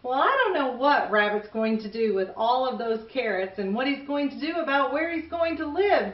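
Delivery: plain reading voice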